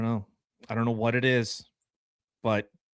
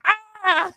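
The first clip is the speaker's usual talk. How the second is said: high startled yelp; 5 dB louder than usual. Retold or imitated. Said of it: "It's like".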